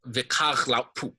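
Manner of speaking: "vikar laupu" with even weight on its last syllables; equal stress. Shown as a demonstration. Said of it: "they're equally stressed".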